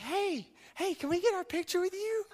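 high-pitched gushing fangirl impression; mock-excited girly squeal; excited girls. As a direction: imitate giddy girls begging for a photo, voice leaping up high. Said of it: "they're all excited and everything".

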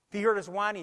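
ordinary talk, nothing marked

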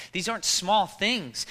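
ordinary talk, nothing marked

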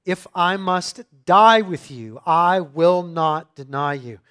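loud, firmly stressed vow, slowing at the end; emphatic. Retold or imitated. Peter vowing to Jesus he will not deny him, emphatic. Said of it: "But he said emphatically".

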